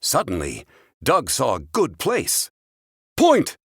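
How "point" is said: high, loud sharp shout. A narrator's reading. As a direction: shout it out suddenly. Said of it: "he shouted".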